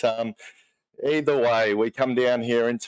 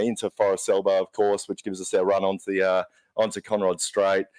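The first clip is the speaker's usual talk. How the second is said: ordinary talk, nothing marked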